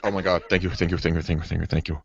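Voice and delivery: soft, rapid thank-yous; a quiet, relieved whisper of thanks; grateful relief. Retold or imitated. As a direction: salesman relieved after the wife agrees. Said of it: "I'm like".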